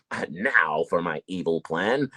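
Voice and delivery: low slow scheming villain voice; mock evil plan. Rapper mocking his supposed scheme, low slow villain voice. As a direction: play the cartoon villain plotting. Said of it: "I didn't just go".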